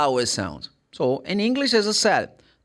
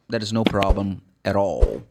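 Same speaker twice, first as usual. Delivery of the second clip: ordinary talk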